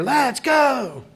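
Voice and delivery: high, loud stadium yell; shouted cheer. Retold or imitated. Girl yelling from hospital window to band, loud cheer. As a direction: belt it out like a fan from a window. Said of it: "out of her window you hear".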